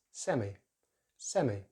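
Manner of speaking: semi ending in ee; British pronunciation. Shown as a demonstration. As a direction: say semi as SEM-ee. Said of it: "in British English it's".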